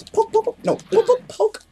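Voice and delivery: high squeaky voice. Pretend. Chanting pokes in high voice.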